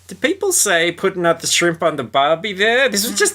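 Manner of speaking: high, far-off ditzy voice for the host; mocking American host. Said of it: "the female American host goes".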